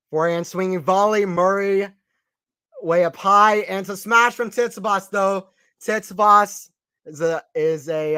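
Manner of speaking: lively play-by-play commentator voice; hint of a broadcast tennis commentator; sports commentary. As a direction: call the match like a TV commentator.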